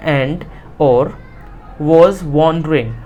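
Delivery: slow, drawn-out reading; slow reading aloud. Shown as a demonstration. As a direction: dictate it to the class. Teacher reads textbook line. (धीरे-धीरे पढ़ना)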